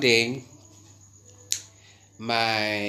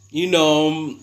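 ordinary talk

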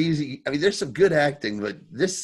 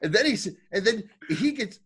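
ordinary talk, nothing marked

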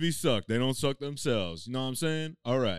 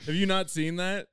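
normal talking voice

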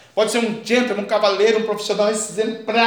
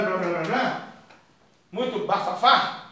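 normal talking voice

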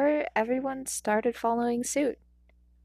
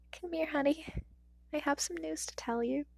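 higher, softer grieving mother; tender mother; 6 semitones higher, 6 dB quieter than usual. A narrator's reading. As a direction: gently call her son close. Mother breaking news.

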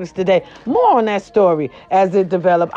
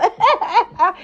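high laugh; 12 semitones higher than usual. Real, not acted. Laughs at her own joke.

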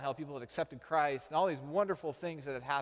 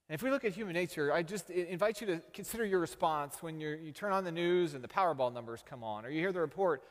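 higher, louder, fast-driving preacher build; preaching at full force; 5 semitones higher, 4 dB louder than usual. Acted. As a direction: preach it, pushing the question at the congregation. Raises the sermon to a peak.

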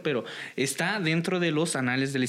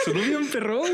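laughing, higher-pitched exclamation; amused amazement; 12 semitones higher than usual. Real, not acted. Co-host admires the guest's Nahuatl, laughing in amazement. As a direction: blurt it out through laughter.

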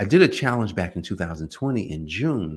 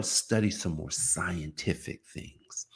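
slow, soft, unvoiced musing; a slow whispered thought; whispered aside; 4 semitones lower, 7 dB quieter, slower than usual. Real, not acted. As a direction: whisper it to yourself, unhurried.

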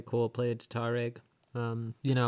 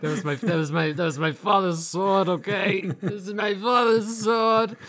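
higher, louder hammed-up protest through laughter; mock outraged adventurer; 8 semitones higher, 8 dB louder than usual. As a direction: wail about your father's sword, half laughing. Acted quarrel over lost sword.

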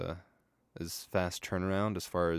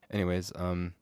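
ordinary talk, nothing marked

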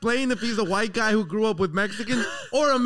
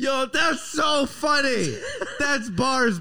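laughing; 6 semitones higher, slower than usual. Real, not acted. Cracking up at joke.

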